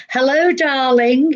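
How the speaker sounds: imitating a camp gentleman, put on thick; flamboyant camp. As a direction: greet them with flamboyant flourish. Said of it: "he was ever so flamboyant".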